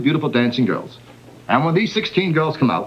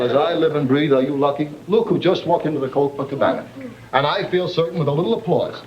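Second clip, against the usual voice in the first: usual speaking voice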